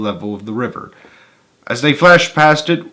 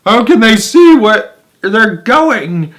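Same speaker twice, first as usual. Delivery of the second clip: high, loud, slow shriek; 10 semitones higher, 9 dB louder, much slower than usual. A narrator's reading. Violet frightened in the dark tunnel, loud high shriek. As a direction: wail it in the dark, much higher and louder. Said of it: "shrieked Violet Beauregard".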